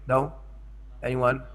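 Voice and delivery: ordinary talk, nothing marked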